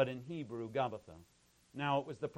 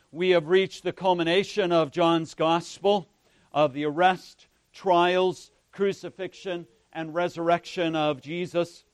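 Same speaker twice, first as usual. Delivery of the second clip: loud, higher-pitched projected sermon voice; preaching; 5 semitones higher, 14 dB louder than usual. Real, not acted. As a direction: preach it out forcefully to the congregation.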